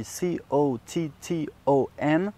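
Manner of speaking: C O T T O N spelled slowly letter by letter; slow letter-by-letter spelling. Shown as a demonstration. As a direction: spell out C O T T O N, one letter at a time. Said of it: "Donc en anglais, on dit".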